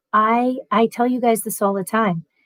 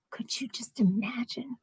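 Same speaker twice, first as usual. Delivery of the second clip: whisper; 15 dB quieter than usual. Real, not acted. Horror at Titanic thought.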